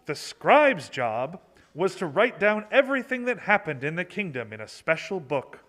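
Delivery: ordinary delivery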